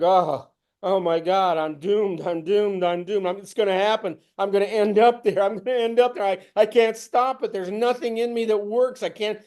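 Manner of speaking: retold despair, mounting; panicked inner voice. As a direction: spiral into doom, pleading. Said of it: "I'm walking around going".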